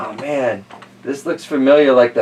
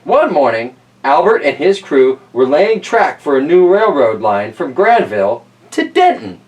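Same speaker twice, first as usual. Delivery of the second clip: lightly lifted, louder reading-aloud voice; storybook reading; 2 semitones higher, 4 dB louder than usual. Acted. Reading Tracks to kids, storybook voice. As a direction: read it out to the children. Reads picture book aloud.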